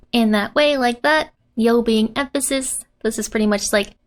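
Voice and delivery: ordinary talk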